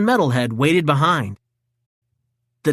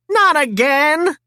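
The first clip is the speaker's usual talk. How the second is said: frustrated shout; 11 semitones higher, slower than usual. A narrator's reading. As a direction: wail it as the staff breaks. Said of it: "he shouted".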